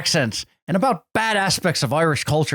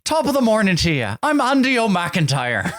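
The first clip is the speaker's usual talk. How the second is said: hammed-up stage Irish greeting, a bit fast; lightly put-on Irish brogue; mock Irish accent; 7 semitones higher than usual. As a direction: play a cartoon Irishman. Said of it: "I'm not gonna do this accent".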